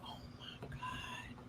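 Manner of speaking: hushed awed whisper. Retold or imitated. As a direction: whisper in disbelief. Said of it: "I was like".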